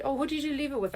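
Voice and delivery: high-pitched mocking imitation; squeaky mimic of the scheduler. Mocking scheduler in retold call.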